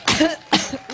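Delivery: quick dry cough; coughing fit. Real, not acted. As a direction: cough between names. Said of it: "once I get over my allergic reaction".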